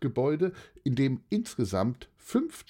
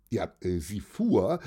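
ordinary delivery, nothing marked

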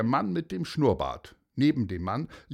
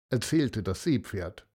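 ordinary delivery, nothing marked

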